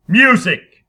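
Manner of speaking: loud, high command. Acted. Harrison commanding the musicians, loud. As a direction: order the music to start. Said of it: "he commanded".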